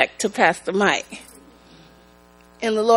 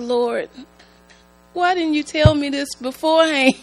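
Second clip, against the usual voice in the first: mock exasperated plea; 5 semitones higher than usual. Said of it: "And I said".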